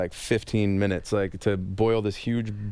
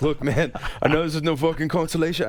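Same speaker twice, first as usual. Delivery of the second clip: put-on failed Scottish accent; 6 semitones higher than usual. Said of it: "I can't do a Scottish accent".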